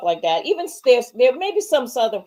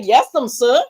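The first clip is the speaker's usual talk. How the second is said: exaggerated old Southern servile accent, louder; mock Southern slave dialect; 5 dB louder than usual. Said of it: "He sounded like a runaway slave".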